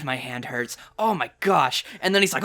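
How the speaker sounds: ordinary delivery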